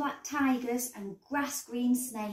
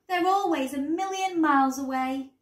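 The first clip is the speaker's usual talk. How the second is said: usual speaking voice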